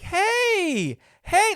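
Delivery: high, bright imitated hello of the owner; excited greeting. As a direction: greet him like an overjoyed old friend. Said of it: "he was really excited".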